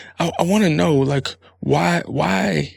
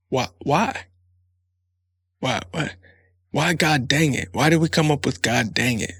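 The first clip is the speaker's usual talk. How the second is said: ordinary delivery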